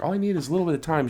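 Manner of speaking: high, fast mock voice for Amber; imitating Amber. Friend quoted mid-scene, high and quick. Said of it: "she was like".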